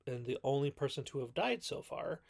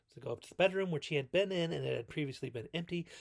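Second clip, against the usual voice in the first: ordinary talk, nothing marked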